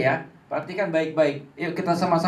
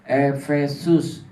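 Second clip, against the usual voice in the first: syllable by syllable; slow and clear; much slower than usual. Shown as a demonstration. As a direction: spell it out slowly for kids. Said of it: "Efesus dua". (pelan dan jelas, suku demi suku)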